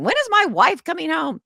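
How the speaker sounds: high, loud mock worried husband; brother's call. Brother on the phone asking for his wife, mock worried. Brother calling, voiced in story.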